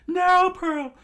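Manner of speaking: high, loud frantic pleading; shrieked high, pleading in panic; panicked crowd screaming. A narrator's reading. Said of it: "freaking out and started screaming".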